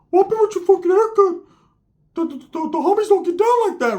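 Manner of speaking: very high, squeaky mock voice; high cartoon voice imitation; Ricky's voice.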